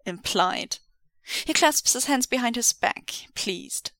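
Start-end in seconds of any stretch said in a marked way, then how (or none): none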